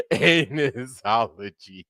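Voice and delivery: drawn-out word through laughter; laughing. Real, not acted. Streamer cracking up at his own ology joke, laughing.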